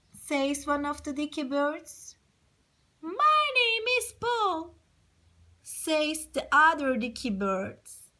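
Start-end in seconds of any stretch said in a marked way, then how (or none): 3.0-4.8 high squeaky little bird voice. Acted. Teacher voicing Peter the little bird, high and light. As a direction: speak up high as a tiny bird.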